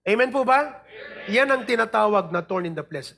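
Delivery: ordinary talk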